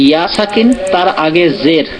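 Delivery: ordinary delivery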